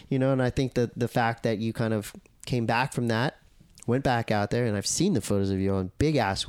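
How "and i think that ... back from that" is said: ordinary delivery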